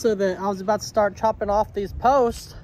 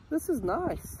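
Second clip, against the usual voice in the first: ordinary talk, nothing marked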